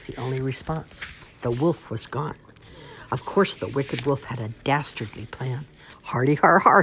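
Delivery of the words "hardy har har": loud, spoken har-har laugh; mock evil laughter in words; wild villain laugh. A narrator's reading. Wolf racing through the wheat, laughing wildly. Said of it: "he laughed wildly".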